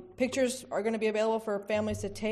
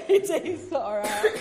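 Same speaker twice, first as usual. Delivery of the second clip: laughing; 5 semitones higher, 7 dB louder, much slower than usual. Real, not acted. Still laughing at mix-up.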